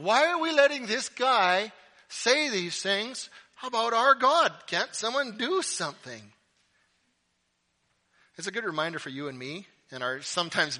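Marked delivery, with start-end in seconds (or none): 0.0-6.3 loud, high indignant appeal; irritated outburst. Acted. David irritated at giant making fun of his God, indignant. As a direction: demand that someone act, outraged. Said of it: "He's irritated that this guy".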